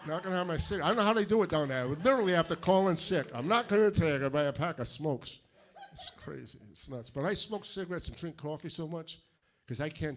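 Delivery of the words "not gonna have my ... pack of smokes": loud, fast, exasperated rant. Acted. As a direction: blow up about the wait. Ranting about cigarette delay.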